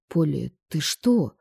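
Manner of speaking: gently alarmed husband; Stas worried. A narrator's reading.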